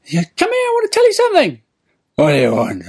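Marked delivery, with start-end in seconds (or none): 0.3-1.6 fast, very high falsetto; little old man. Retold or imitated. Old man beckons the tramp closer, quick high falsetto. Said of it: "it's a little old man". 2.2-2.8 put-on drunken tramp. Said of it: "the tramp goes".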